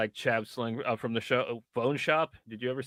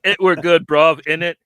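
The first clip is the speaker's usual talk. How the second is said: put-on louder, cocky London chav accent; hammed-up chav accent; 2 semitones higher, 8 dB louder than usual. Friend mocks Boris Johnson reading the book, chav accent. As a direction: play a chav giving a lazy verdict, loud. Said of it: "like a proper chav".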